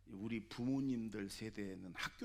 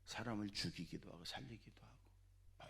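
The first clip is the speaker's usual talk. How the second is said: much softer whispered aside; 4 semitones lower, 12 dB quieter than usual. Real, not acted. 회중에게 무게를 주며 속삭임. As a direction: whisper it to let it sink in. Hushed emphasis in sermon.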